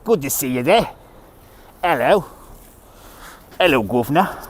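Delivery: playful British greeting accent; put-on UK accent. Shown as a demonstration. Streamer showing off the best UK accent, put-on British greeting. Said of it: "I got the best U K accent".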